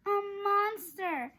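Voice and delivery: high, loud cry of alarm. A narrator's reading. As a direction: cry out, pointing in fright. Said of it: "Annie cried".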